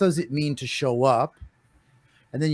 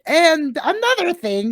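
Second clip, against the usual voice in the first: much higher, louder, playful voice; mock eager add-on; 13 semitones higher, 5 dB louder than usual. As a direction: jump in like a know-it-all. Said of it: "and then I'm just like".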